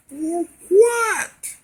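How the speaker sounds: high, loud, disbelieving yelp; incredulous outcry. Retold or imitated. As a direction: blurt it out in high-pitched, indignant disbelief. Retold reaction to spanking threat.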